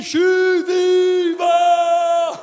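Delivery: drawn-out high joyful yell; shouting I'm alive. Shown as a demonstration. Comic urging the crowd to yell, drawn-out joyful shout. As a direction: belt it out, stretched long. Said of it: "Tu devrais être debout en train de crier".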